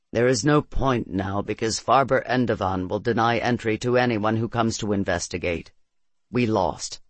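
low, defeated voice; dejected resignation. A narrator's reading. Claris shakes his head at the narrator's hope, resigned. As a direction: give up, sunk low.

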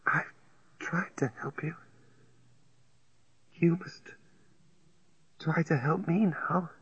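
weak whisper with long pauses; slow with pauses. A narrator's reading. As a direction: whisper slowly, pausing between phrases. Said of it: "She didn't speak quickly. There were pauses".

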